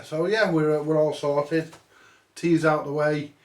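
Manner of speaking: usual speaking voice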